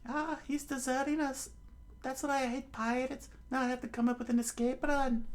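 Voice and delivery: high falsetto panic; put-on female fluster; Nami freaking out. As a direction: fret rapidly in a squeaky girl voice. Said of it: "And Nami freaked out".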